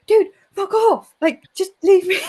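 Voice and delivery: high, loud mock-exasperated outburst; exasperated retort. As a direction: retell it as a fed-up, laughing brush-off. Retells telling Fred to go away.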